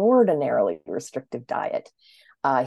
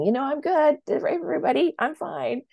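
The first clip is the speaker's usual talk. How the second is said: high, breezy cheerful voice; body saying it's fine; 12 semitones higher than usual. Retold or imitated. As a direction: chirp it carelessly, all good. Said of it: "and saying".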